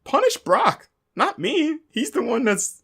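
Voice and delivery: high-pitched imitation of begging; mock pleading. Manager begging not to be fired, pleading in a high voice. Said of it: "begs Kurt not to do it".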